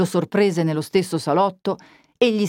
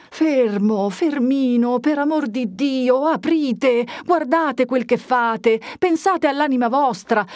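high, slow frightened begging; pleading priest; 7 semitones higher, slower than usual. A narrator's reading. Frightened priest begging the young man to unlock the door, pleading. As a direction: beg him to open the door.

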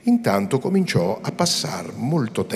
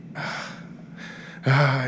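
startled exclamation; 6 semitones higher than usual. A narrator's reading. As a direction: shake yourself out of the daze. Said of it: "esclamò Lucia riscuotendosi". (esclamazione di chi si riscuote)

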